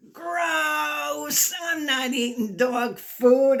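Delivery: high, disgusted cry of refusal; grossed-out dog voice; disgusted bark. A narrator's reading. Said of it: "Oliver barked".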